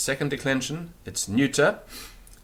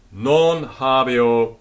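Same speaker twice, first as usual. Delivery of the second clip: slow drill; 2 semitones higher, 8 dB louder, much slower than usual. Shown as a demonstration. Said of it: "slow teaching drill".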